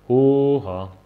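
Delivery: a loud, high, arms-wide Whoa; loud mocking whoa; loud outburst. A narrator's reading. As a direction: throw it out loud with open arms.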